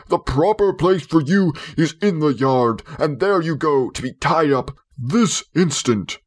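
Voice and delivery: lightly voiced blustering father commanding; stern order. A narrator's reading. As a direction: bark the order at the dog. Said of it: "father ordering dog out".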